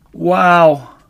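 high, loud gasp of wonder; amazed gasp. A narrator's reading. Smallest fox after a gulp of cider, amazed gasp. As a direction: gasp it out, bowled over. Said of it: "he gasped".

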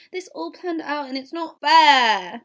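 acted protest, slow and loud; loud whining complaint. Eve complains to Oliver that her plan failed, loud protest. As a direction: drag it out, loud and put out.

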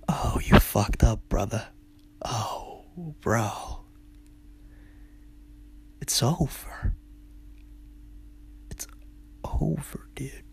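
hushed, breathy doom whisper; ominous whisper. Retold or imitated. Whispering to his buddy that it's all over. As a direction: whisper it like a death sentence.

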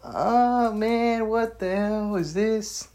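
high, slow, baffled "what the hell is this"; imitating his own bewildered first reaction; confused reaction. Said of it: "I was like".

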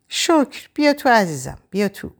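high, fond auntie voice; affectionate welcome. A narrator's reading. Aunt Maryam invites Ava inside, affectionate. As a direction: usher her in, pitched well up.

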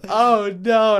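high, loud, laughing alarm; imagining mom in danger, laughing panic; laughing mock panic. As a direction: ham up the worry through laughter. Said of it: "I was sitting there thinking".

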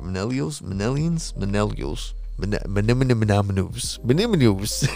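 "Manellials", "Manellians", "Manems": stumbling tries at millennials; laughing at himself, garbling "Manems"; fumbling the word. Real, not acted. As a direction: trip over "Manellials" again and again. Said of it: "I can't even say the word".

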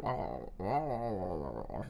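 slow, soft gargled babble; mock incoherent gargle; imitated gargling. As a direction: mimic a man choking on his words. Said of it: "he's like".

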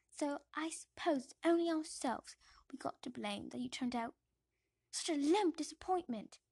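loud, scornful aunt scolding; stern aunt. Acted. Aunt Petunia scolding young Harry, loud and scornful. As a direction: lecture him with contempt. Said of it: "says Aunt Petunia".

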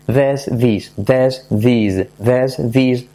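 drilled vowel switch between "this" and "these"; E versus I drill. Shown as a demonstration. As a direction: alternate "this" as "dhess" and "these" as "dhees". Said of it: "simplemente pensar E, I".

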